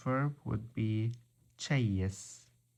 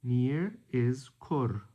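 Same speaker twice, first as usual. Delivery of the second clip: normal talking voice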